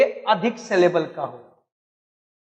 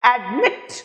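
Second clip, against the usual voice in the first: Admit with मिट punched and rising; teacher's demo of admit, weight on मिट; stress on second syllable; slower than usual. Shown as a demonstration. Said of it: "मिट पे जोर है".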